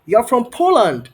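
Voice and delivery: high, loud exclamation; delighted surprise. Real, not acted. Tutor learning student's country, high and loud surprise. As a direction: burst out in amazement.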